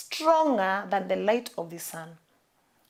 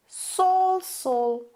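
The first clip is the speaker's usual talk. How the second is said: heavenly voice calling; 8 semitones higher than usual. Acted. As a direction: call out Saul from heaven. Voice from heaven calls Saul.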